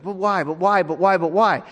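put-on a kid's voice nagging with why after why; pestering child. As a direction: whine it like a curious little boy who won't let go. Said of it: "But he would say".

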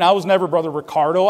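ordinary talk

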